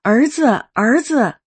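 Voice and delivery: a mother rushing home, calling out to her son; excited calling out. Retold or imitated. Mother bursting in, calling her son with excitement. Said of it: "激动地高声喊".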